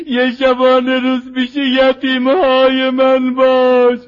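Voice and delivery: plaintive rawzeh, growing in force; rising mourning plea. Acted. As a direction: plead for the orphans in a swelling lament. Lament building over orphans' plea.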